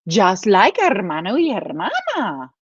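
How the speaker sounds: ordinary delivery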